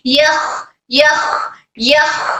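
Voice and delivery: "यख" ending in a long, airy kh; airflow on kh. Shown as a demonstration. Teacher drills kho for students, "यख" with flowing breath. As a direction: keep breath streaming through the final kh of "यख". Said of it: "खो में air flow होना है".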